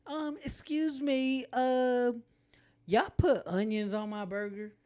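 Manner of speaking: high, slow fussy customer voice. Retold or imitated. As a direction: whine about the order. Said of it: "I'm not really like".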